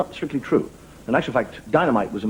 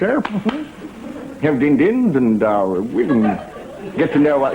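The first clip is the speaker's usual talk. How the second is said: chummy British colonel making suggestions; hammed-up CO with hums and little ehs; commanding officer. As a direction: coax the lieutenant like a jolly old-school officer. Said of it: "people who talk like that".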